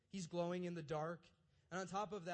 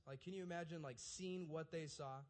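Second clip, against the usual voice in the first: normal talking voice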